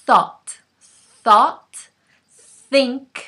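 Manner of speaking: "Thought" with air pushed out between the teeth; voiceless th. Shown as a demonstration. Teacher exaggerating the breathy th for students. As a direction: blow air over the tongue for "Thought". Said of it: "al principio es exagerado".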